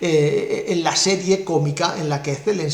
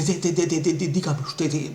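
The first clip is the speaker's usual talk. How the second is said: stammering in fear; 3 dB quieter than usual. Retold or imitated. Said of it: "muerto de miedo".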